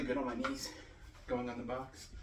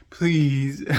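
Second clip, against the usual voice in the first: loud playful plea; pleading; 3 semitones higher, 10 dB louder than usual. Real, not acted. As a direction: beg them to subscribe.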